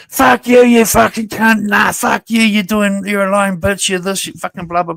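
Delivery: high, loud mock rant of insults, fading out at the end; mocking furious yell. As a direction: imitate someone screaming abuse into the mic, then let it die off. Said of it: "screaming into my mic like you do".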